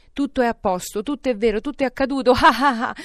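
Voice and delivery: excited outburst breaking into loud laughter; giddy elation erupting in a belly laugh; splendid laugh. A narrator's reading. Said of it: "questa era una splendida risata".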